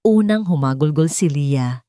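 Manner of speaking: plain reading voice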